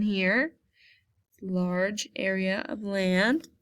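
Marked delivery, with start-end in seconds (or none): none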